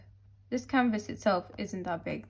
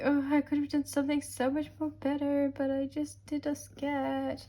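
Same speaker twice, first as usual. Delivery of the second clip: whiny self-talk; 6 semitones higher than usual. Retold or imitated. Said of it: "But I'm just like".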